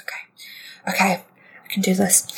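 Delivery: nervous, mostly whispered pep talk; whispered self-encouragement. Acted. Boy psyching himself up at the door, whispering. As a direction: whisper it to yourself to build nerve.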